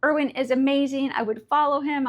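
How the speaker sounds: ordinary talk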